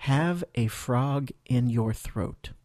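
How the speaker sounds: slow, careful teacher model; slow model phrase. Shown as a demonstration. Teacher models the phrase slowly for learners. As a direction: say it slowly for students to repeat. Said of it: "écoutez et répétez".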